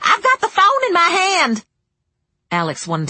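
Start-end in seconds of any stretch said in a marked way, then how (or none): 0.1-1.6 very high, loud threat; shrill clerk's holler; shrill call. A narrator's reading. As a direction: screech it across the store. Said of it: "Beverly called shrilly".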